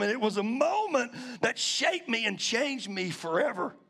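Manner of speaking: normal talking voice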